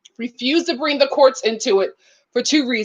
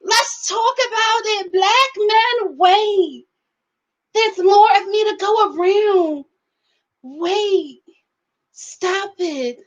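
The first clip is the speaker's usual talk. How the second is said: high, mocking voice of a woman loving the fight; mocking Sarah; 5 semitones higher, slower than usual. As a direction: parody a woman egging two men on, hamming it up. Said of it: "She wants to be in the middle and be like".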